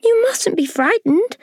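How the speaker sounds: very high, gentle reassurance; kindly ladybird. Acted. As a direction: soothe the scared boy. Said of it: "the ladybird said kindly".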